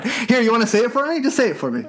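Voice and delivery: giggling through the words; laughing playful tease. Real, not acted. Teasing co-host to say it.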